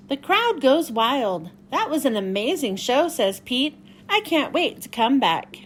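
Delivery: bright, lively picture-book read-aloud; reading to children. Acted. Miss Michelle reading Pete the Cat to young viewers, lively story-time voice.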